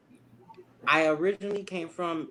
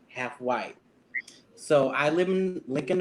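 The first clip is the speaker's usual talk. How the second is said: ordinary talk, nothing marked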